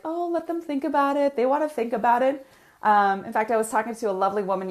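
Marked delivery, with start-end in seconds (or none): 0.0-2.5 a hint of a high wheedling inner voice; little inner voice. Coach voicing the little voice to listeners, lightly higher. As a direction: lightly voice the doubt that says let them think. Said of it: "the little voice comes up like".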